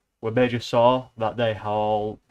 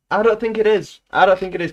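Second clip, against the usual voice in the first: indignant denial; 8 semitones higher, 7 dB louder, faster than usual. Real, not acted. Rebutting chat complaint.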